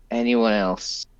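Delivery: ordinary talk, nothing marked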